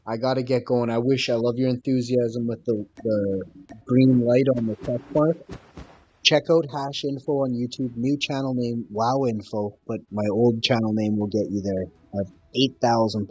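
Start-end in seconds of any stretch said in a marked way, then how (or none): none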